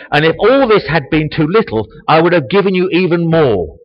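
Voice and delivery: loud, high proclamation with rising force. Shown as a demonstration. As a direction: thunder it as God's rebuke. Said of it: "did Nathan raise his voice".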